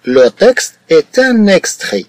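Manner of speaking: Le texte est un extrait, each word drawn out very slowly; slow, word-by-word dictation. Shown as a demonstration. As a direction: dictate it slowly so students can copy.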